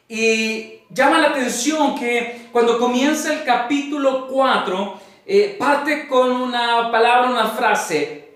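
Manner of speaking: ordinary talk, nothing marked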